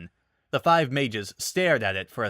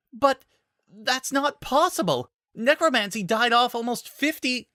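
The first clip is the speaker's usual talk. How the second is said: alarmed disbelief; 13 semitones higher, 3 dB louder than usual. Acted. Disbelief at necromancy news.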